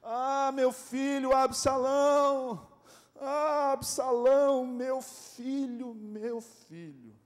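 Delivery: very high, slow wail fading into a broken whisper of a cry; keen for your son, then let the voice die away; grieving lament. Acted. Preacher voicing David mourning Absalão, slow fading wail. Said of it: "Eu vejo essa entonação do Davi".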